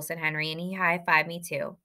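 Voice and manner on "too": usual speaking voice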